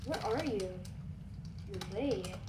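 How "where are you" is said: normal talking voice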